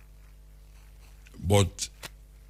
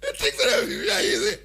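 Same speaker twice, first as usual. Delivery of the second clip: laughing. Retold or imitated. Laughing while voicing passenger.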